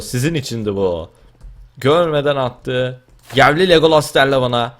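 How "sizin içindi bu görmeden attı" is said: ordinary talk, nothing marked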